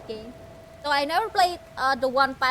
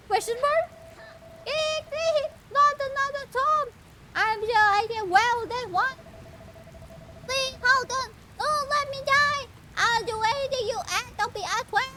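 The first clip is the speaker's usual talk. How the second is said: high, pleading mock panic; hammed-up high frightened plea; panicky character voice; 11 semitones higher than usual. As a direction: beg for your life in a pitched-up squeal. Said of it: "doing the voice impression of that one".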